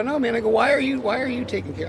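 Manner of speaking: loud, high, heated yell; exasperated retold outburst at a coworker; retold shout. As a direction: shout him down in disbelief. Retold heated argument at work.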